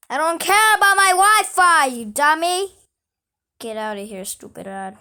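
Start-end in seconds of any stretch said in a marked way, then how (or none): 0.1-2.8 high, loud scolding shout; yelling at an ad. Real, not acted. Player yelling at an annoying ad, angry shout. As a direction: tell off the stupid ad.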